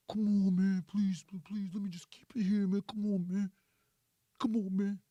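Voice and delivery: weary low "come on" plea; pleading. Acted. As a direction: plead with her, voice kept low. Man begging in acted quarrel.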